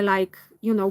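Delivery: usual speaking voice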